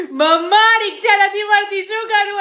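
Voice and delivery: mock the daughter calling for help; mimicking whiny daughter. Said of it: "જો હવે કઈ નહીં બોલે".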